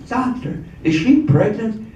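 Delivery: high, incredulous question; alarmed. Acted. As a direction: play a shocked nurse confronting a doctor. Shocked nurse confronts the doctor.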